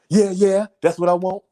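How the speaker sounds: high, mocking teen voice; lightly voiced smug kid; imitating the son. Mocking the son who wants them split, high. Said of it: "son voiced".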